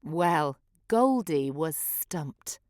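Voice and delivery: ordinary delivery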